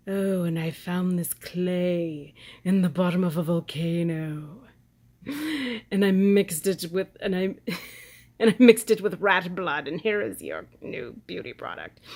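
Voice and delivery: hammed-up pretentious ad voice. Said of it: "she sounds so pretentious".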